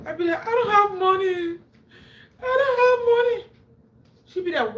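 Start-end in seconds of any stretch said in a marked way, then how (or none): 0.5-3.5 high, loud wailing plea; half-laughing fake sob, high and loud; mock crying. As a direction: wail it out like a crying child. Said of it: "So I started crying".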